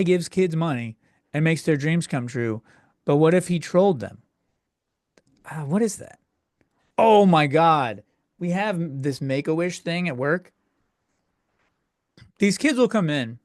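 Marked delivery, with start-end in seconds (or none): 5.4-8.0 louder, drawn-out real surprise; suddenly noticing something odd; startled. Real, not acted. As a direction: blurt it out slow and loud.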